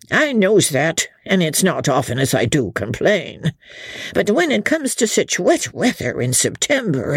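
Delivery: put-on old man grumbling to the landlord about rain; grumbling old villager. A narrator's reading. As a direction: complain like a pious old countryman. Hempseed grumbling speech.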